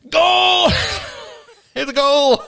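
very high, loud mock goal scream; sportscaster goal shout. Sportscaster after days of inhaling, explosive goal shout. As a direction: explode into a hammed-up soccer call. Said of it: "they're like".

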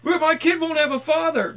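high-pitched imitation of a complaining parent; put-on high voice of an objector; mock protesting parent. Mimics objecting parent in higher voice.

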